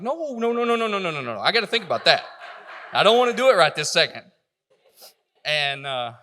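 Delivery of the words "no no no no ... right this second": rising, much higher and louder string of no's; loud high-pitched protest, getting louder; panicked refusal. Retold or imitated. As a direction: fend off the offer in a flustered rush. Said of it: "And I was like".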